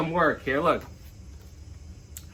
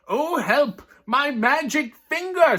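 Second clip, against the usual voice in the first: higher, pleading yelp over a lost finger; mock cry for help; 8 semitones higher, slower than usual. Giant wails to Finn's wife about his finger, hammed-up. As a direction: wail it like a hurt giant.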